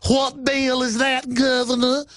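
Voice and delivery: mock Southern drawl, high-pitched and drawn out; fake Southern accent. Radio host mimicking a congressman questioning Ventura, fake Southern accent. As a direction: stretch it slowly in a high, put-on Southern accent. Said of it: "with his fake Southern accent".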